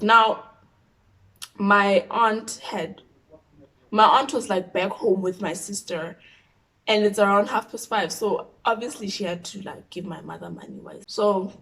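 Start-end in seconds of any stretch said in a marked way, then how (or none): none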